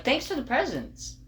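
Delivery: high mock-sweet thanks; sarcastically grateful, voice up; fake gratitude. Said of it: "You were like".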